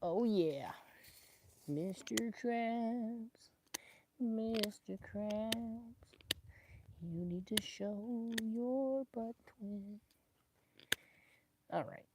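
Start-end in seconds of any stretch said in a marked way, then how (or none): 1.7-10.0 slow, high teasing voice; slow cartoon voice. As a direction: drag it out in a silly voice. Sing-song teasing voice.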